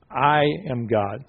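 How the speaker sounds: slow, emphatic I set apart; stress on I. Shown as a demonstration. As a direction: lean on I and stretch it out. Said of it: "put the emphasis on the I".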